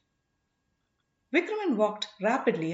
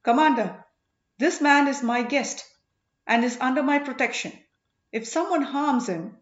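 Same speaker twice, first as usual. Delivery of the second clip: loud, high, angry warning; shaking with anger; 5 semitones higher, 6 dB louder than usual. A narrator's reading. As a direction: protect the guest with fury. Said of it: "Kundavi was shaking with anger".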